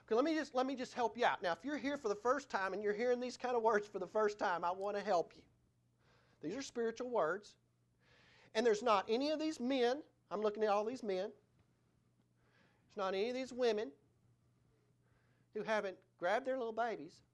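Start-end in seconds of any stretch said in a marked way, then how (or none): none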